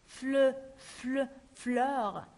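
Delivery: FLEU, then FLEUR, with rounded "uh"; teacher building FLEU into FLEUR; corrected eu vowel. Shown as a demonstration. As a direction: say FLEU as in French E, then add the r. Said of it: "c'est comme la lettre E".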